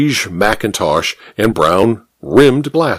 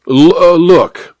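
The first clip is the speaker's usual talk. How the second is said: hesitant interruption; 6 semitones higher, 4 dB louder, slower than usual. A narrator's reading. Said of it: "interrupted the policeman".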